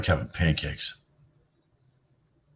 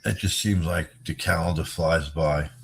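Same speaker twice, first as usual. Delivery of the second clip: ordinary talk, nothing marked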